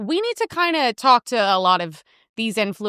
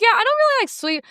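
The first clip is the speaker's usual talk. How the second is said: sarcastic quip; 12 semitones higher than usual. Retold or imitated. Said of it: "I'm like".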